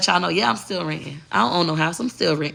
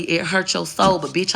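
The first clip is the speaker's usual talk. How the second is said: ordinary delivery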